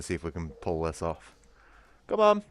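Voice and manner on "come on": high coaxing pet call; calling the cat. Real, not acted. Streamer calling his cat to the camera in a high coaxing voice. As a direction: coax him over, high and sweet.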